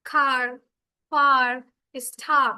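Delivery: খার, ফার, স্টার said loud with the r ringing; loud r-controlled demo. Shown as a demonstration. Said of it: "As for examples". (জোরে আর-ধ্বনি দেখিয়ে)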